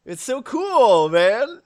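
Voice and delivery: "cool" drawn out, "man" lifting like a question; slow, loud cheer with questioning "man"; sounds like a question. Real, not acted. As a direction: stretch "cool" loud and high, then swing "man" upward. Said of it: "that sounded like a question".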